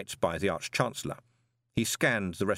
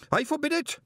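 screamed order. A narrator's reading. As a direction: shriek it to shut him down. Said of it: "screamed Greenyham".

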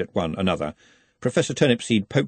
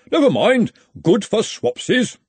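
breezy dismissal; 13 semitones higher, 5 dB louder than usual. Acted. Henry, breezy.

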